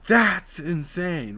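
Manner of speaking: high, loud and slow excited line. Shown as a demonstration. Choir tip demo, slow excited emphasis. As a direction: stretch it out with excitement. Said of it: "Sound more exciting".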